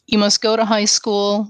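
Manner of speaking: ordinary talk, nothing marked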